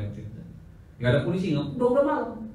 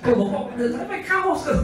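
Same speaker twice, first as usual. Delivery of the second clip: high, quick excited chatter; bubbly young fan; excited girl; 12 semitones higher, 4 dB louder, faster than usual. Retold or imitated. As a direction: gush about winning the bet. Young woman excitedly explains bet.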